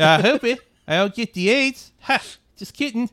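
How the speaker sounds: loud mock South African accent. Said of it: "Or you could just do the Musk impression".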